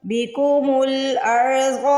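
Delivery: बिकुमुल अर्ज़ slow, with a thick full र; heavy ra. Shown as a demonstration. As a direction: round out the र in अर्ज़ heavily. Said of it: "रॉ को मोटा पढ़ना है". (रॉ मोटा पढ़ें)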